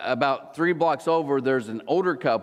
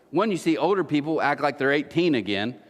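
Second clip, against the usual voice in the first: usual speaking voice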